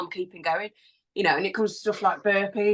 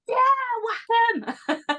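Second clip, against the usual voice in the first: carefree confidence; much slower than usual. Retold or imitated. Mimics her own carefree confidence now.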